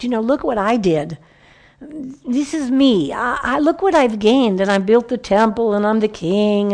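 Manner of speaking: strut through it like a proud king showing off; a smug, self-admiring boast; boastful king. Retold or imitated. Preacher voices boastful King David.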